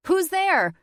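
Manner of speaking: high, loud alarmed yell. Acted. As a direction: call out sharply at the intruder. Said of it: "before yelling out".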